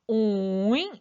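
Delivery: scoop 오잉 down and back up, drawn out; third tone. Shown as a demonstration. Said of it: "삼성". (오잉을 길게 내려갔다 올라가게, 삼성으로)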